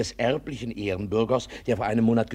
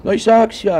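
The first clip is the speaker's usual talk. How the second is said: much higher and louder, crowing; triumphant; 9 semitones higher, 10 dB louder than usual. Acted. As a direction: crow that you were right all along. Busybody triumphantly repeats his point. (triumphierend, viel höher und lauter)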